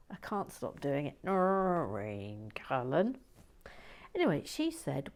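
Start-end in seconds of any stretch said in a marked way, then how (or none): 1.2-3.2 slow, drawn-out Irish name with a rise; soft Irish accent. Pretend. Naming Noreen Cullen in a slow soft Irish accent. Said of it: "the soft Irish one that I can do".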